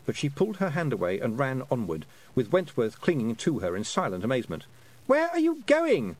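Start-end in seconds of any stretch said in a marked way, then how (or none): 5.1-6.2 high, loud slow call; shouting boy. A narrator's reading. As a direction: call after her, shouting. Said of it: "shouted Roland behind her".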